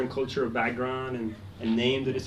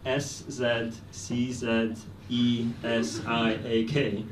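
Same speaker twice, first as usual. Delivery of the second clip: slow spelling out; 3 semitones lower, much slower than usual. Shown as a demonstration. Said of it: "my last name is spelled".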